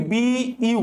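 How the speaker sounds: slowly part "বিইউ" into bee and you; "বিইউ" drawn out as two syllables; slow teaching model of "বিইউ", bee, then you; syllable-split pronunciation. Shown as a demonstration. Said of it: "ফার্স্টে আমি তোমাকে বুঝাই যাচ্ছি".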